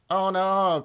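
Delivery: high, loud groan of alarm; dismayed cry. A narrator's reading. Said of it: "cried Harold".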